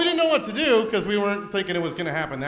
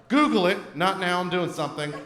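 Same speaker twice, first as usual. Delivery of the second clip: normal talking voice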